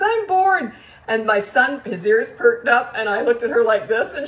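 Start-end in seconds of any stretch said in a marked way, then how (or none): 0.0-0.7 imitating a little girl, high and loud; high-pitched kid's complaint; less snotty whine. Daughter home from a friend's, mildly snotty complaint. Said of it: "didn't say it quite as snotty".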